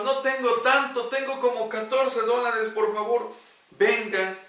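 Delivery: loud, high begging; desperate high plea. Acted. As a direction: plead with all you have left. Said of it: "Hudson le dijo". (súplica desesperada y aguda)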